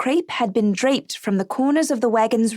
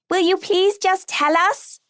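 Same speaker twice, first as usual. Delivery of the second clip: put-on pleading child; 9 semitones higher than usual. Lucy begs grandmother.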